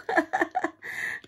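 a breathy high real laugh at the joke. Real, not acted. Laughing at the paramedic's joke about the dog, real laugh. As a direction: let a quick real laugh burst out.

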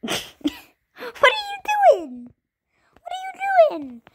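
high squeal, laughing, stretched out slow. Real, not acted. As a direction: squeal it at a puppy. Squealing at pet dog.

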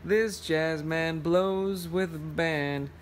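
usual speaking voice

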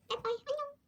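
high, sing-song little bye-bye; cutesy goodbye. Real, not acted. Signing off to her viewers, cutesy high goodbye.

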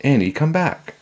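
high, urgent call; shouting after her. A narrator's reading. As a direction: call her back from afar. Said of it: "Jack shouted".

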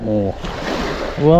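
normal talking voice

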